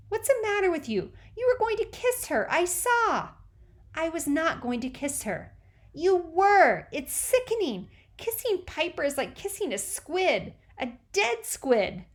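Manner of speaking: put-on high, loud child voice, disgusted; little sister scolding her brother; scolding little girl. A narrator's reading. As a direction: scold him like a prim kid sister. Teresa scolding Moose.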